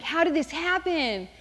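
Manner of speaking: stretched-out bewildered question; mock dismay. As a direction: wail it lightly in comic dismay. Retold dismay at the pregnancy.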